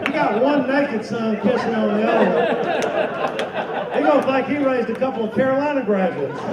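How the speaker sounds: shouted comic punchline; loud punchline. Real, not acted. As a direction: belt the joke out to the room.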